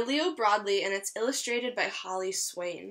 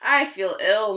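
moaned; 8 dB louder, slower than usual. Acted. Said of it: "moaned the wolf".

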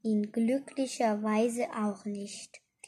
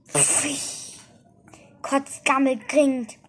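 slightly louder cursing; mild roar; 3 semitones higher, 4 dB louder than usual. A narrator's reading. Ette curses being invisible, mild roar. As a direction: curse with a touch of outrage. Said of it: "brüllte er".

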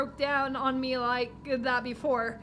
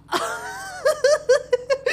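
much higher giggling laugh; 13 semitones higher, 6 dB louder than usual. Real, not acted. Laughing before the apology, very high. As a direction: break into giggles.

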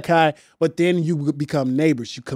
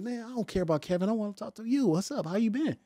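much softer, intimate pickup line; soft smooth talk; 4 semitones higher, 12 dB quieter than usual. Retold or imitated. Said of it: "I'm like".

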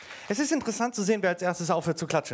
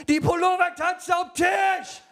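slow, loud, high, panicked cry; panicked shout; 9 semitones higher, 5 dB louder, slower than usual. Retold or imitated. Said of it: "Du klingst panisch".

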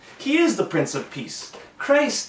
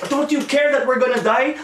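mock panicked scream; 6 semitones higher, 3 dB louder than usual. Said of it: "screaming at him".

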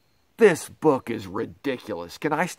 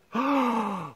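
high breathy delighted gasp; hammed-up gasp; 8 semitones higher, 5 dB quieter than usual. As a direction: gasp like you are charmed. Reader flattered by book.

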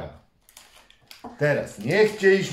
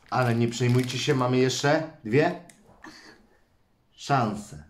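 usual speaking voice

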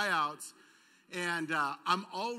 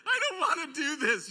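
put-on whiny kid; 8 semitones higher, 6 dB louder than usual. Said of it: "And I'm just like".

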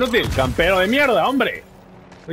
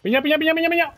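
excited call; 7 semitones higher, faster than usual. Real, not acted. Shouting grenade in game.

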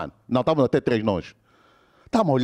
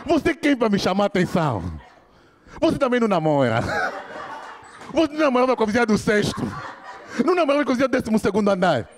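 rising retort; 5 semitones higher, faster than usual. Retold or imitated. Friend hits back.

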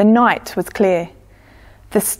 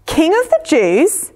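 higher, incredulous royal question; barely suppressed upset; 8 semitones higher, 4 dB louder than usual. A narrator's reading. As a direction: repeat it in rising disbelief, upset leaking through. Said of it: "trying hard not to look upset".

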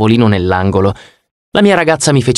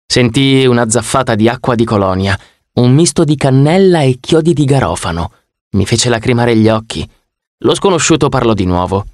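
ordinary delivery

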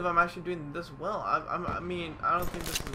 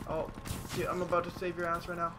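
ordinary talk

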